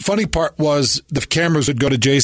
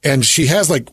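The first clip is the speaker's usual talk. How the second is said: normal talking voice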